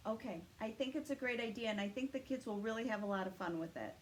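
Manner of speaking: plain reading voice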